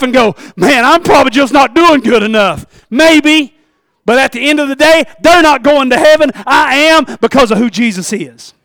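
loud fervent sermon delivery; rising full-voiced preaching; preaching at full force. Real, not acted. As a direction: preach it loud and high to the congregation.

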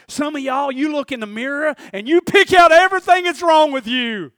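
loud, high-pitched preacher shout; fired-up preaching. Real, not acted. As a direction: build to a full-voiced holler at the congregation. Preaching at full force.

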